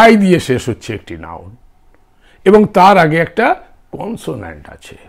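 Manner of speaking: ordinary talk, nothing marked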